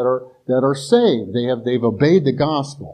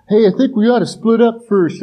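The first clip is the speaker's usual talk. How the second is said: higher, louder mock movie-victim line; horror movie character; 9 semitones higher, 5 dB louder than usual. Horror movie character to friends, mock eager suggestion. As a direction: play a naive horror movie character, pitched up. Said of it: "when the people say".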